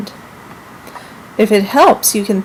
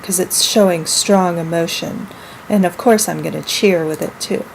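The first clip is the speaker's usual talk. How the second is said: normal talking voice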